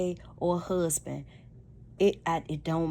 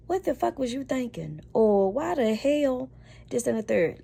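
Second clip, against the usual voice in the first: much higher, sharp mock demand; angry wife; 7 semitones higher, 4 dB louder than usual. Wife confronting her husband, mock angry outburst. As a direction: fire it off like an upset wife. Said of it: "her delivery was".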